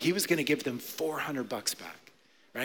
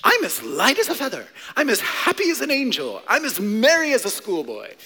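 high, loud, giddy exclamations; joyful Scrooge; 6 semitones higher, 5 dB louder than usual. Acted. Reformed Scrooge wakes up one morning, overjoyed. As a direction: burst out with acted Christmas-morning delight. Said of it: "wakes up one morning and he's like".

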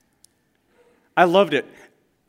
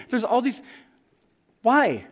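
ordinary delivery

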